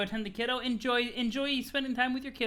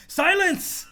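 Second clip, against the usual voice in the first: Jade shouting silence, higher and louder; 1 commanding shout; 9 semitones higher, 7 dB louder than usual. Acted. Jade silencing a mocking Roxy, loud command. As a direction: bark the order to shut up.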